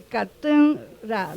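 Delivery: katura drawn out very slowly, stressing the second syllable; slow stress demo. Shown as a demonstration. Teacher confirming the stress rule on katura, very slow. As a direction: stretch katura out so they hear the stress. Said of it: "Y sí se cumple".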